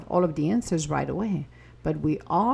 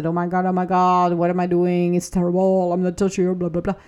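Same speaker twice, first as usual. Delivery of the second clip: louder, hammed-up panic; mock freak-out; 4 dB louder, faster than usual. Mocking a listener freaking out over a choice, louder panic. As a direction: rattle it off like someone mildly freaking out. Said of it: "freak out and say".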